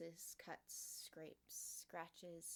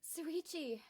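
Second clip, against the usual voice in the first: relieved cry; 8 semitones higher, 4 dB louder than usual. Acted. Relieved hug.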